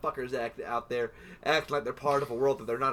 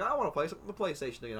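rushed thought; 2 semitones higher, 5 dB quieter, much faster than usual. Retold or imitated.

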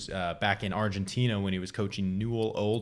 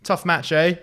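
high, louder mock-kindly jab; British condescension; 7 semitones higher, 5 dB louder than usual. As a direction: feign kindness with condescension underneath, very British. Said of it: "in the most British way ever".

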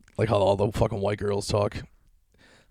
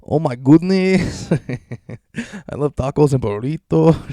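mock [name]; 5 semitones higher, 3 dB louder, much slower than usual. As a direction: parody a cartoon puppet voice. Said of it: "South Park [name]".

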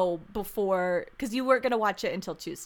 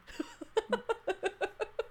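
breathy laugh; 11 semitones higher, 8 dB quieter than usual. Real, not acted. Laugh after rant.

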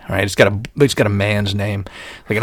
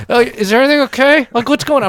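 much higher, lips barely moving; ventriloquist voice; 14 semitones higher, 4 dB louder than usual. Retold or imitated. Calling out to the technician from inside the tube, ventriloquist talk. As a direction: squeeze it out like a ventriloquist. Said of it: "trying to talk like a ventriloquist".

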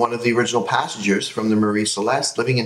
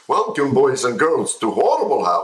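cheesy TV host; 5 semitones higher, 4 dB louder than usual. Retold or imitated. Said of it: "one guy does all the parts".

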